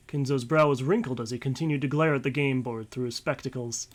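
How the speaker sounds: usual speaking voice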